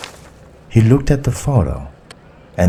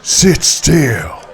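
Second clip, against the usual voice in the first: higher barked order; commanding shout; 7 semitones higher than usual. A narrator's reading. Bearded hijacker orders passengers at gunpoint, commanding shout. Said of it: "pointed his gun at the passengers and shouted".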